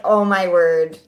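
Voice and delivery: laughing oh my word. Retold or imitated. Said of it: "I was like".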